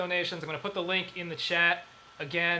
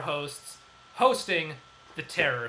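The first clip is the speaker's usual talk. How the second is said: slow, low, weighty title drop; dramatic slowdown; 4 semitones lower, slower than usual. Acted. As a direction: drag out hosting The Terror ominously. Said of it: "dramatic title announce".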